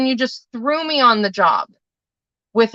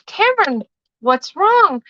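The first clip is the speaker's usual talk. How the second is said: lightly voiced teacher, higher and gently asking; concerned teacher; 9 semitones higher than usual. Mom voicing the kindergarten teacher asking her son, gentle and high. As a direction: play the teacher kneeling to a crying boy, a little higher. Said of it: "she goes up to him and she says".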